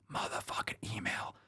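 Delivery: whispered aside. Real, not acted. Host giving email instructions, whispered aside. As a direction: drop to a whisper for the curse.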